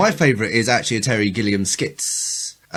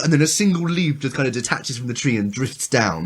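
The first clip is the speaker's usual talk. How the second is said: usual speaking voice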